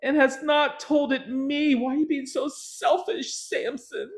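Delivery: high mock-whining wife; hammed-up nagging wife, high. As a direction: keep the high nagging whine. Wife continues her complaint.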